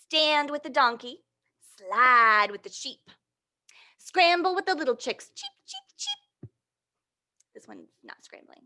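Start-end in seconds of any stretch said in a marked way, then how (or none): 0.0-5.3 put-on lively read-aloud to little kids; reading to children. Reading picture book aloud. 5.3-6.5 very high, soft little chick peeps; tiny squeaky chick cheeps; cheeping chicks. Acted. Imitating chicks in story.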